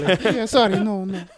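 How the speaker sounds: high-pitched mock maid accent; Consuelo. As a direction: apologise in a put-on foreign housekeeper's accent. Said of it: "That was a great impression".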